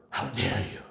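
lightly voiced Greta Thunberg meme; a hint of mock outrage. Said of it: "feel like Greta Thunberg".

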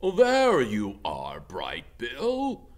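loud, slow goose call; loud honking goose voice. Acted. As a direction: call out over the crowd like a goose. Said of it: "Loudwing honked".

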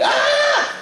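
high piercing shriek; a sudden high cry; child's scream. Retold or imitated. Little girl cries out.

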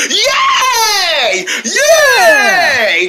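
high, long-held squeal of joy; excited cheer. Acted. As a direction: cheer in a high, drawn-out shout. Friends cheer about the movies.

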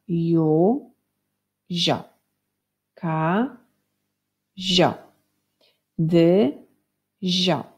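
"Ju, zio", each syllable held apart by a pause. Shown as a demonstration. Teacher shows pupils how syllables join into words, syllable by syllable. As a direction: split "Ka, zio" and "Dy, zio" into separate syllables. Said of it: "będziemy łączyć sylaby".

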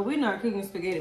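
ordinary delivery

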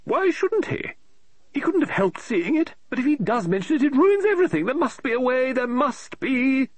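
high, fussy woman's voice, rising in exasperation; an eccentric lady author tearing her hair; fretting novelist. Acted. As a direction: novelist complaining aloud about her plot, high and exasperated. Mrs Oliver frets over her plot.

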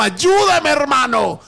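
sudden high anguished cry, voice cracking upward; desperate cry for help. Retold or imitated. Retold man crying out to his pastor, pleading shout.

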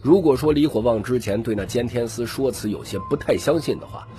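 plain reading voice

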